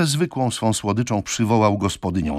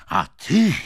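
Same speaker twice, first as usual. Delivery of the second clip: accusing; 10 semitones higher, 5 dB louder than usual. A narrator's reading. Turns his anger on sister.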